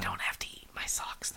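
soft low whisper. Real, not acted. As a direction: whisper close to the mic. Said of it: "that's the whisper point".

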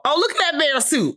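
high, delighted squeal at the bear suit; excited squeal. Retold or imitated. Host squealing at the bear suit, high and delighted. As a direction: squeak it out like a thrilled kid.

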